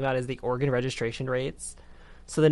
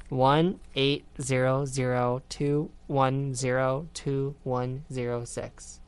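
very slow, digit by digit; slow read-out; much slower than usual. Real, not acted. Host reading a phone number for listeners to write down, slowly. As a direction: read each digit slowly for note-taking. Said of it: "I'm gonna read it slow".

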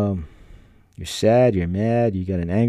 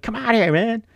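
high, coaxing call; friend calling out; 14 semitones higher than usual. Retold or imitated. Friend coaxing him onto the balcony, high call. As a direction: wave him over to the balcony.

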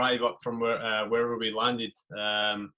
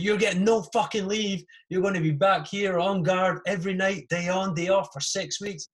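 furious shout; 9 semitones higher, 5 dB louder than usual. Retold or imitated. Sergeant major screaming at the late private, furious shout. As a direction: roar it like an enraged sergeant major. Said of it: "he just flips the fucking lid".

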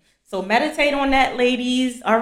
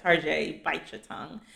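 inner warning; 5 semitones lower, 9 dB quieter than usual. Retold or imitated. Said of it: "it's just like".

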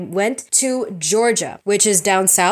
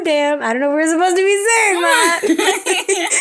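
laughing; 6 semitones higher than usual. Real, not acted. Laughing at her own slip.

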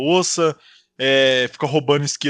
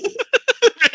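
gargalhada aguda; 4 dB louder than usual. Real, not acted. Riso após piada.

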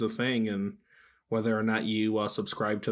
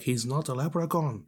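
put-on Irish accent, slightly raised; 3 semitones higher than usual. Friend voicing Irish people objecting, mock Irish accent. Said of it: "Irish people would be like".

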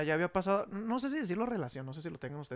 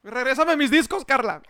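mock demand; 3 semitones higher, 9 dB louder than usual. Joking demand to ex.

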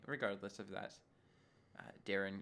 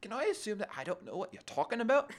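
louder, higher Irish-style lilt, amused; mock Irish accent; 10 semitones higher, 7 dB louder than usual. Shown as a demonstration. Redoing Darren's question for a laugh, mock Irish accent. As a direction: play up a rough Irish accent. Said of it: "like, Irish".

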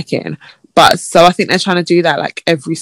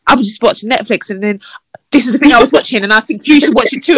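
higher, louder mock-eager quote; enthusiastic imitation; 5 semitones higher, 3 dB louder than usual. Woman retelling a match's keen messages, mock enthusiastic. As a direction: gush like an over-keen match. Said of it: "It was very enthusiastic".

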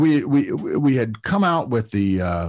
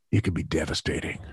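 dry deadpan; 7 semitones lower, 7 dB quieter than usual. Retold or imitated. Deadpan reply to sarcastic reporter.

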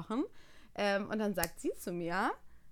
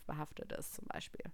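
whisper; 5 semitones lower, 14 dB quieter than usual. Real, not acted. Whispered aside.

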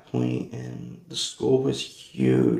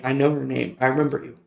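usual speaking voice

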